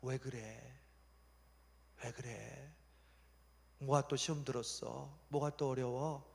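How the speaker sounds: acted tender concern; gentle, not scolding. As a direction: coax out what is troubling them. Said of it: "하나님 우리를 혼내는 게 아니에요".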